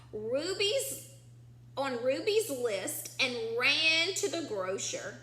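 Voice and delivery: ordinary talk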